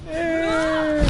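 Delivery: high anguished shout. Real, not acted. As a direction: wail it as you lose. Dying in game.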